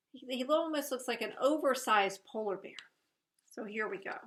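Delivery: ordinary delivery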